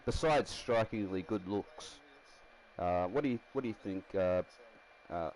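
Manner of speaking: ordinary delivery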